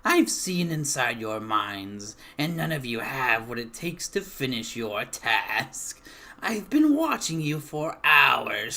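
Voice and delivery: put-on loud, taunting old hag voice. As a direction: gloat over them like a witch. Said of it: "The hag cackles and".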